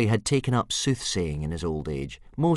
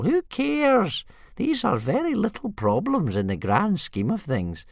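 put-on high old man, louder, brushing it off; dismissive old man; 4 semitones higher, 4 dB louder than usual. Old Wrinkly ignoring Hiccup, high and breezy. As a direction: wave away his worries.

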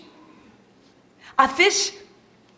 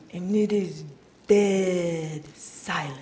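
hushed; 7 semitones lower, 6 dB quieter, slower than usual. Acted. Describing the silence after the fall, hushed. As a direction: lower and softer, slow on dead silent.

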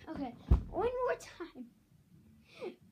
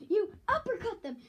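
usual speaking voice

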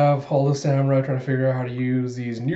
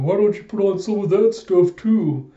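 higher, louder put-on voice; imitated loud, pitched-up jab; Sanji, needling; 7 semitones higher, 6 dB louder than usual. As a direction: call it out in an acted character voice.

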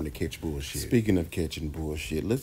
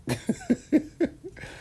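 high laugh. Real, not acted. Laughs before calling "child.

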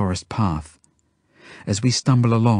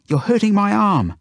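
put-on high, raised child's complaint; pained protest; 12 semitones higher, 5 dB louder than usual. A narrator's reading. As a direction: cry out in protest at the rough grip. Zelda protests at being hurt.

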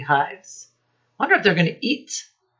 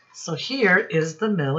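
normal talking voice

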